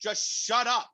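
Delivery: high-pitched, drawn-out mock outburst; strained high voice, acting out exasperation; exasperated manager. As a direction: imitate a fed-up boss pleading for silence. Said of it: "my G M will be like".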